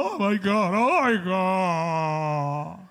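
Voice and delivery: stretched, swooning mock gasp; mock gushing crowd. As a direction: gush like a moved crowd. Multitud conmovida imitada. (imitación exagerada de una multitud conmovida)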